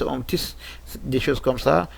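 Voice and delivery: usual speaking voice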